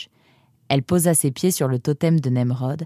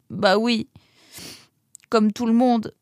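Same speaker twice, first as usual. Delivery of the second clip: high, halting tearful answer; sobbing reply; 7 semitones higher than usual. A narrator's reading. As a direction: answer between sobs. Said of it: "répondit-il en sanglotant".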